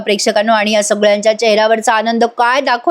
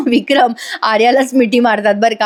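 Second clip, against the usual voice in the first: ordinary delivery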